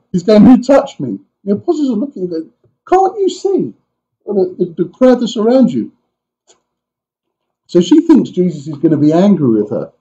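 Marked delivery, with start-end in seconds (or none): 0.4-1.2 higher, louder sharp question; urgent question. Acted. Jesus asking the crowd urgently who touched him. 2.9-5.9 much higher, exasperated disbelief; mock the obvious, voice leaping up; incredulous retort. Apostles answering Jesus in exasperated disbelief.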